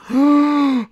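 sharp high mock gasp of shock; imitated gasp. Fan retelling his reaction to Rock's line, high shocked gasp. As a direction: suck in air like it just hit you. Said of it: "and I was like".

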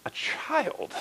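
breathless whisper; hushed aside. Acted. Preacher revealing child sacrifice in a whisper. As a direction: drop to a shocked whisper.

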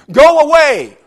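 high, loud shout of go away; screamed retort. Retold or imitated. Teenage daughter screaming at her dad to go away. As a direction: yell it through the bedroom door. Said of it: "she screams back at him".

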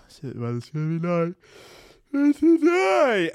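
rising to a loud high whine on today; grumbling awake, then a loud high yelp; groggy dismay. Acted. Checking the phone on waking, grumbling into a loud high yelp. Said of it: "you're just like".

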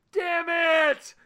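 sudden loud, high shout; retold outburst. Fan seeing a spoiler on Twitter, shouting. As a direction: yell at the phone at dawn. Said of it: "So it's just like".

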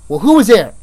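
loud, high, impatient question; rude interrogation. Retold or imitated. Officer pressing detainee about the party, rude. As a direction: act out a testy interrogator. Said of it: "I was rude to her".

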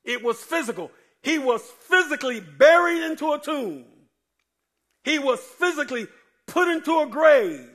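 loud, high, building to a shout; shouting preacher climax. Acted. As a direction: whoop it up like a revival preacher.

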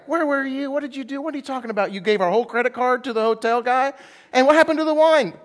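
high-pitched mock indignant wife; scolding wife. Wife confronting Samaritan home from his good deed, mock outrage.

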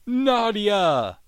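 a high call of the name. Retold or imitated. Captain calls the siren back onto the boat, a high call. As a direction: summon her across the boat.

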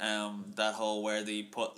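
normal talking voice

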